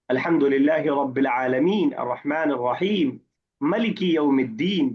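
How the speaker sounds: slow Quranic recitation with ملک said short, no long a; another qirat reading. Shown as a demonstration. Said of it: "آپ نے مالک کی جگہ ملک کر دیا ہے". (قرات میں آہستہ تلاوت، ملک کو مَلِک پڑھیں)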